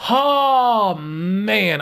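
loud, high fed-up "Oh, man"; exasperated groan. Real, not acted. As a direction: groan at yet another roadblock. Trade blocked again.

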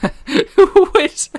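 high, loud, squealing through laughter; laughing disbelief. Real, not acted. Player suddenly stuck in a glitch, laughing disbelief. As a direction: crack up while getting the words out.

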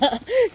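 터져 나온 짧은 웃음; 참지 못하고 웃기. Real, not acted. 물 튀기며 웃음. (짧게 웃음)

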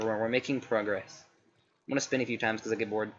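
usual speaking voice

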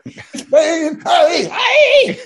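high laughing yells; yelling. Retold or imitated. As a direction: jeer loudly at the photographers. Spitting at photographers, yelling.

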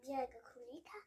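usual speaking voice

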